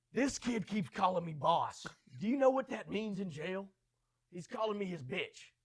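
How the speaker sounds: loud, high, slow angry complaint; irate West Texan customer. Retold or imitated. Angry customer confronting the young manager, loud mock outrage. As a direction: imitate an indignant big man, hitting each word hard. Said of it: "It's a West Texas tight end. He said".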